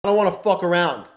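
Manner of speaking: normal talking voice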